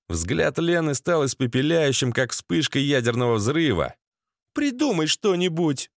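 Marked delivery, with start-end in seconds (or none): 4.5-5.9 lightly voiced higher female demand; urging woman. Lena demands a plan from Sasha, higher-pitched urging. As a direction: press him in a raised pitch.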